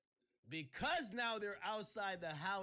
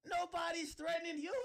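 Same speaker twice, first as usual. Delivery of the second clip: higher, louder shouted repeat; 8 semitones higher, 6 dB louder than usual. Real, not acted. Telling Desiree nobody threatens her, shouted again. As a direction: blow up on the repeat.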